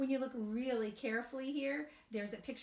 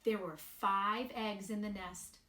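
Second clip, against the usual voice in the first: slow, sing-song storybook reading; gentle storybook narration with light character voices; reading to children; 2 semitones higher than usual. A narrator's reading. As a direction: read it aloud to a group of little kids. Reads picture book to children.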